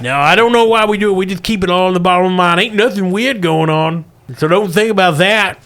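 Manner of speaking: put-on fast, nervous old miner; old prospector. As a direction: rush the denial like a shifty old miner. Russell hides the mine's secret.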